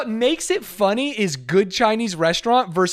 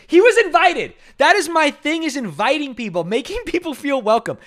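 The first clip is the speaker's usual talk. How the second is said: louder, higher insistence; raised indignant insistence; 8 semitones higher, 4 dB louder than usual. Real, not acted. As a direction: insist it hotly. Indignant defence of himself.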